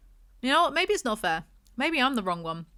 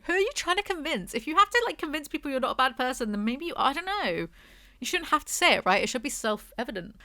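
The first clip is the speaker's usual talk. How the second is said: incredulous scoff; 6 semitones higher than usual. Real, not acted. Reviewer mocking the heroine's self-assurance. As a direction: question her sarcastically.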